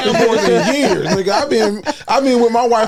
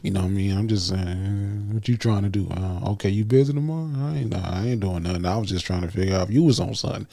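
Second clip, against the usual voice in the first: deep low seductive murmur; sexy voice; 12 semitones lower, 8 dB quieter than usual. Pretend. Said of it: "sexy voice".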